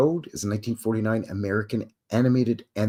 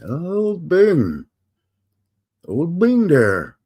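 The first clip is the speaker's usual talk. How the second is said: higher, louder swooping crooner voice; 7 semitones higher, 6 dB louder, much slower than usual. Acted. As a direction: croon it big and drawn out, badly imitated. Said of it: "terrible [name] impression".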